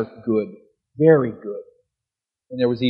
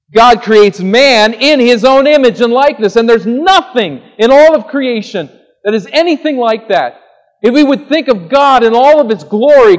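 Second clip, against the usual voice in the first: much higher and louder, ringing out; full-force preaching; 9 semitones higher, 10 dB louder than usual. Acted. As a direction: proclaim it at the peak of the sermon. Climax on man's dignity.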